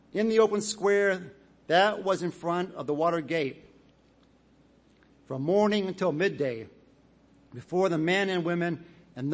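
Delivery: scripture reading. Acted. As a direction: read it out to the whole assembly. Reads Nehemiah eight aloud.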